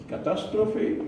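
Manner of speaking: ordinary talk, nothing marked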